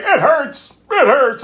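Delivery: high, loud mimicked pain; hammed-up victim crying out; mock cries of pain. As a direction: wail it in mock agony. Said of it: "then she's like".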